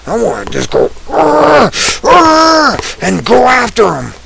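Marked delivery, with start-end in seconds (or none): 1.1-2.8 mock anime power-up yell, high and loud; Goku battle cry. Ranter imagines blasting the evil people, mock Goku battle yell. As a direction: roar like he's charging an energy blast. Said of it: "I wanna go Goku on 'em".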